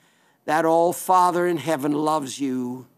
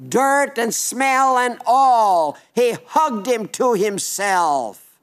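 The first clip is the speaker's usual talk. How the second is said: slow and emphatic; 3 semitones higher, slower than usual. Acted. Father embraces dirty son.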